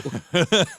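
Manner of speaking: trailing chuckle; amused laughter. Real, not acted. Reacting to a friend's silly quote, amused laughter. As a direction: laugh along with your friend.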